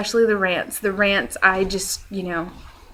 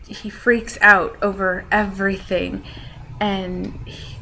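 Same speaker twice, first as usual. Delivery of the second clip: usual speaking voice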